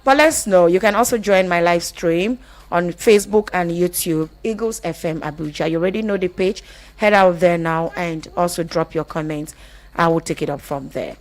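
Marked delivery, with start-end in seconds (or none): none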